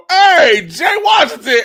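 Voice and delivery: high, loud fan shout; calling out. Retold or imitated. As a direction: holler a name across the arena. Acts out a fan calling his name.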